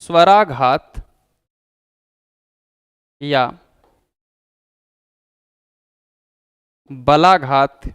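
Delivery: slow, spaced dictation with long pauses; teacher dictating notes, one phrase at a time; dictation pace. Shown as a demonstration. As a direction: read it out phrase by phrase for students to write down. Dictating the definition.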